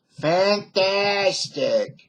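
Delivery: very slow, high, drawn-out fantastic with a Dutch lilt; imitating the Dutch premier saying fantastic; Bill Van der Zam impression. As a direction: stretch out fantastic like a proud Dutch governor. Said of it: "And he always said".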